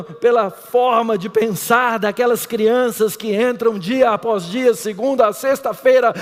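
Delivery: preaching. Acted. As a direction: drive the point home to the church. Pregação crescendo. (pregação em crescendo)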